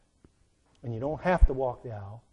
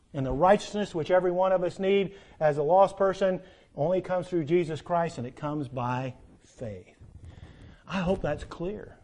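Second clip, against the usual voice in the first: normal talking voice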